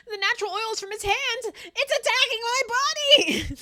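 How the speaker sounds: high, slow, loud mock agony; imitating Caleb City. Retelling a sketch of a character in a hammed-up, high, slow wail. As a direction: ham up a melodramatic victim. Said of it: "He said".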